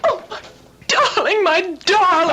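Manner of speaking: high, shaken and tearful; alarmed. Acted. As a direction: near tears with worry. Wife rushes to upset husband.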